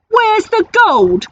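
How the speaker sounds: slow, high, loud demand; shouting pirate. Acted. Angry pirate captain demands the gold from the troll, slow high shout. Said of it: "shouted Hank Chief".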